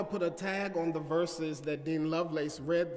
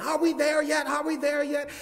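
put-on higher, louder whiny child; child nagging aunt and uncle, higher and louder; impatient kid; 8 semitones higher, 4 dB louder than usual. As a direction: pester like a bored boy in the back seat. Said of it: "I would ask it again".

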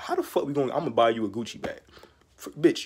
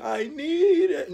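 whiny mock plea; 13 semitones higher, 5 dB louder, much slower than usual. Mocking needy people.

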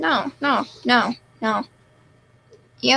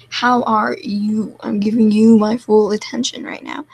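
exaggerated asking; 4 dB louder than usual. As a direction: stretch out each word. Mock-earnest question repeated.

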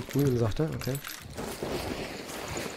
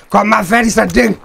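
loud triumphant yell; excited shout; 7 semitones higher, 10 dB louder than usual. Real, not acted. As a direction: cheer the kill out loud. Winning a fight in the game.

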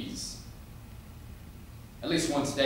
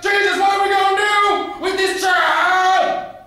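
frenzied scream; 15 dB louder than usual. Acted. Father cries to Jesus about his child, frenzied screaming. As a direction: bellow it to heaven at full force.

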